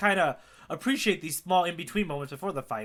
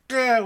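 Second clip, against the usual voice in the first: a short, loud growl, higher; imitated growl; 7 semitones higher, 6 dB louder than usual. Fan mimicking Usopp's effort with the slingshot, growling. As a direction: growl like he's straining. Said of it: "you see him kinda do, like, the".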